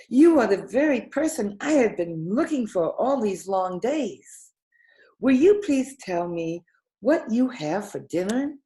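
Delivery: put-on a panting child blurting his question; breathless. A narrator's reading. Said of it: "grew all breathless and panted".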